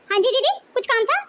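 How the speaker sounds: normal talking voice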